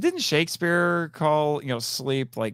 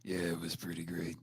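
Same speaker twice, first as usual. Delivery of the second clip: much lower, very soft mumbled impression; Don Fry voice; 8 semitones lower, 16 dB quieter than usual. Answering a coworker about the weekend in a low mumble. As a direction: drop way down into a murmur. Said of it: "I'm Don Fry".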